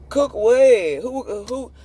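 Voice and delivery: high, loud mocking "Cook what?"; mocking disbelief. Real, not acted. Mocking question at critics.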